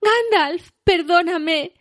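very high, loud, pleading cry; desperate plea. A narrator's reading. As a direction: beg Gandalf's forgiveness, near tears. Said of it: "gritó". (súplica desesperada muy aguda)

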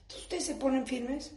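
normal talking voice

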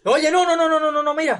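high, rapid panicked no's. Acted. Husband begging wife not to rub the lamp, panicked. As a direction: stop her in a rush, alarmed. Stopping her rubbing lamp.

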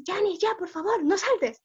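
a mock-stern older sibling telling her off; grumpy big brother. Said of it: "mi hermano mayor siempre era el más enojón".

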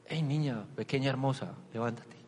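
quiet loving coax; tender wake-up call. Retold or imitated. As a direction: wake a little girl gently. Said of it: "era algo así como decirle".

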